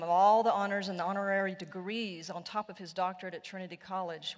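ordinary talk

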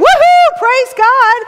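high, loud whoop of praise; exuberant praise. Retold or imitated. Paul's warning, imitating the loud worshipper's whoop. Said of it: "you're over here saying".

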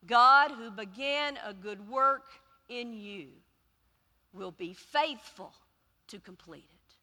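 slow, solemn reading, fading at the end; measured scripture recitation. Acted. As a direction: read it like holy words. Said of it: "that goes like this".

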